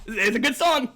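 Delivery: usual speaking voice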